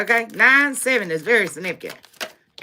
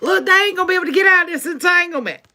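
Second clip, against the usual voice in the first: gleeful; 7 semitones higher, faster than usual. Real, not acted. Gleeful prediction about the doubters.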